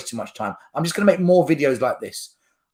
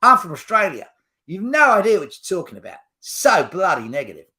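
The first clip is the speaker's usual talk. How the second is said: Australian accent; 3 semitones higher, 4 dB louder than usual. Shown as a demonstration. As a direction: do a hammed-up Aussie complaint. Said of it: "let me do an Australian accent".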